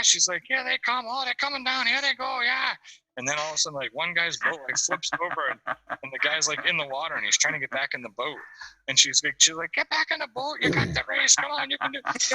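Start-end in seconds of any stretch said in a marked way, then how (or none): 0.5-2.7 high-pitched mock cheering; excited spectator. Teller as woman filming boat race, high excited imitation. 9.7-12.3 very high mock holler; imitated yelling. Teller as woman urging fallen boater, high mock yell. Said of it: "She's yelling at him".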